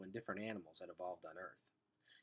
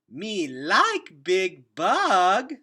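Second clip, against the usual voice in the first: put-on very slow, high, loud little-girl voice; satisfied toddler; 8 semitones higher, 15 dB louder, much slower than usual. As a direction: stretch it out like a pleased small child. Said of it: "said Boots with satisfaction".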